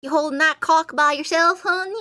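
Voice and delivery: high, loud Southern belle flirting; mock Southern belle line at the range; Southern accent. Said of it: "true Texan Yuna".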